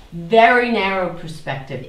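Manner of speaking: ordinary delivery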